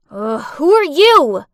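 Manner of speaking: loud, slow, irritated challenge; irritated demand. Acted. Captive girl to stranger in her room, irritated demand. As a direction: demand it loudly, fed up.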